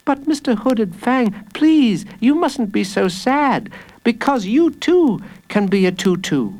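put-on high, earnest little boy; pleading child. A narrator's reading. Jacob comforts the furious villain, high pleading child voice.

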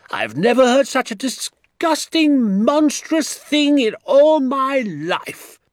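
very high, loud outrage; furious tirade. A narrator's reading. Doctor raging to father about Hazel's traps, furious shouting. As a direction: rant furiously, stretching the strongest words. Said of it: "jumping up and down with fury".

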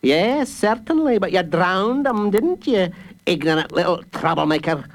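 high, scornful guard voice; guard mocking the boy. A narrator's reading. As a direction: jeer at the little troublemaker.

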